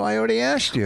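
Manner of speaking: high, put-upon replay of himself; high mock-exasperated retort. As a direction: act out your own annoyed comeback. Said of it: "Which I go".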